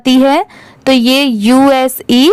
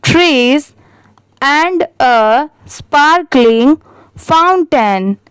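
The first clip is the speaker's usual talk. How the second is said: slow read-aloud; much slower than usual. Shown as a demonstration. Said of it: "इसे ऐसे पढ़ेंगे".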